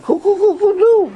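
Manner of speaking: high laughing rooster cry; rooster crow. Retold or imitated. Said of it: "And he screams out".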